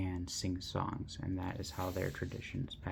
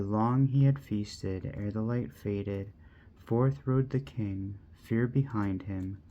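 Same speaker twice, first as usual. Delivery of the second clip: bold, rhythmic minstrel-style reading with louder peaks; poem recitation; 5 dB louder than usual. Acted. Vlogger reading the ride of the Rohirrim, stirring recitation. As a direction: chant it like a Rohan minstrel's lay.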